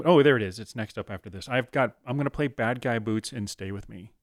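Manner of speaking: ordinary talk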